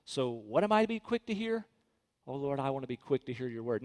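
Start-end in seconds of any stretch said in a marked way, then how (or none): 2.3-3.8 low, soft, quicker murmured prayer; hushed prayer. Acted. Believer to the Lord, a quiet, humble prayer. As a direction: drop down and pray it quietly. Short prayer spoken to God.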